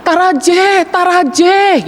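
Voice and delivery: high shout of taraje, taraje. Retold or imitated. Sundanese man in the well yelling for a ladder, high. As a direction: yell up for a ladder. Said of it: "dia teriak".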